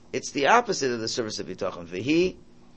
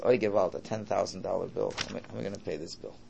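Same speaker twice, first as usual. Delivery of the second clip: worried Yiddish-tinged voice, softer; fretting, getting quieter; 5 semitones lower, 6 dB quieter than usual. Retold or imitated. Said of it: "the lower level bitachon says".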